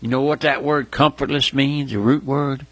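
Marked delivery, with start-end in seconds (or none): none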